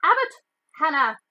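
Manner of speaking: high, loud roll call; calling out a name. A narrator's reading. McGonagall calls the next student to the stool, loudly. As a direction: call the name across the hall.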